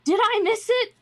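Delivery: high, loud, excited arrival; Renair. Acted. Game master as latecomer driving up, excited shouting. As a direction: call out eagerly from the carriage. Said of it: "you see Renair on the front go".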